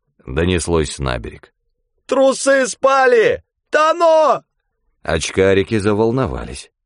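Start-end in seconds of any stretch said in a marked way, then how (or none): 2.1-4.4 very high, loud panicked shout; desperate scream. Acted. Mitka screams to the shore that he is drowning. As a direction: scream for help from the water.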